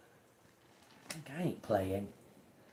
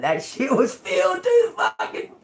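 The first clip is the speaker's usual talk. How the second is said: high laughing outburst, louder; laughing disbelief; 7 semitones higher, 3 dB louder than usual. Real, not acted. Telling viewers about the rock-hard piñata, laughing out loud. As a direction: crack up at how hard it was.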